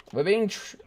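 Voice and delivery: ordinary delivery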